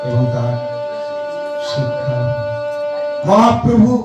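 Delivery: ordinary talk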